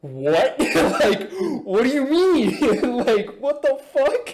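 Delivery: high, loud squeal of disbelief; incredulous dad. Retold or imitated. Storyteller acts out dad hearing about the mamba, incredulous. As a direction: imitate a dad flabbergasted, voice shooting up high. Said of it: "my dad's just like".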